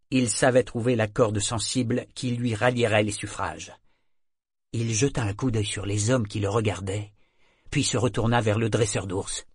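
usual speaking voice

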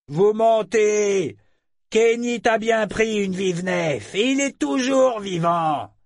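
high, loud, very slow defiant reply; angry retort. Acted. A pirate retorting to Ambre, loud angry defiance. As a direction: fire it back at her. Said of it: "riposta un des hommes".

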